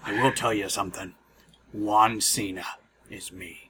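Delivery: low, quiet conspiratorial Ventura voice; lightly voiced secretive tough guy; Jesse Ventura impression. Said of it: "your Jesse the Mind the Body Ventura impression".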